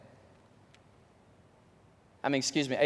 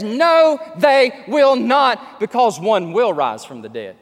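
loud, high, slow no; preacher shouting Jesus' reply; shouted refusal; 5 semitones higher, 9 dB louder, slower than usual. Retold or imitated. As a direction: thunder the answer.

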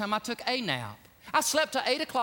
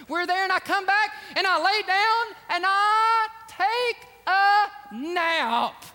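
excited storytelling; 7 semitones higher, 4 dB louder than usual. Real, not acted. Excited nap story, loud and high.

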